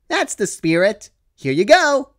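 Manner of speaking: high, chirpy pony voice, upbeat; cheerful Pinkie Pie. Acted. Pinkie reassures Dash she'll do the work, high and chirpy. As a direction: chirp it brightly.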